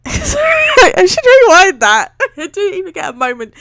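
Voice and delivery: laughing through the apology; giggling, high and loud. Real, not acted. Laughs at own misread line.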